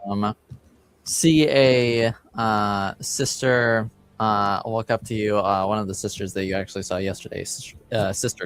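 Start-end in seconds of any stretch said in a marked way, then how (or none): none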